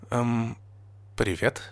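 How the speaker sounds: soft, hesitant greeting; unsure. A narrator's reading. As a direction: offer a timid, halting hello. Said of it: "неуверенно сказала Нина".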